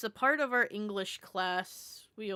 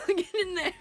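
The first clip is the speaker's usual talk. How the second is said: laughing; 10 semitones higher, 6 dB louder than usual. Real, not acted. Laughing at own cat voice.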